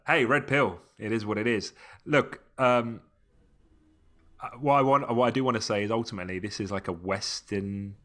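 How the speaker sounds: normal talking voice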